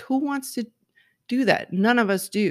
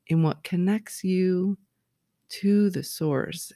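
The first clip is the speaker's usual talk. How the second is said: ordinary talk, nothing marked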